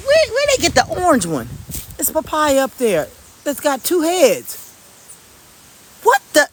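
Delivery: can't believe the two heads; surprised. Real, not acted. To viewers, amazed at a double papaya.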